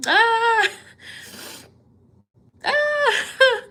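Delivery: high-pitched happy sob; real squeal on the edge of tears; emotional wail. Real, not acted. Reader touched by a viewer's gift, high tearful wail. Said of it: "I'm so emotional".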